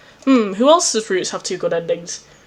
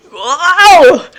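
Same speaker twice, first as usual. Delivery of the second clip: high, loud drawn-out wow; thrilled shout; 7 semitones higher, 7 dB louder than usual. Real, not acted. Player cheering as Equius appears, thrilled shout.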